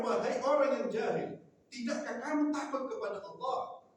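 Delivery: loud, high scolding rebuke; harsh rebuke. Shown as a demonstration. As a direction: call out the ignorant one sternly. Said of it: "Ini contoh kata-kata keras seperti itu". (teguran keras dengan suara tinggi dan lantang)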